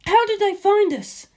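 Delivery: a very high, tense question; alarmed Lisa Lisa. A narrator's reading. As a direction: ask in shock as the base falls.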